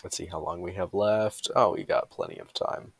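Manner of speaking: ordinary delivery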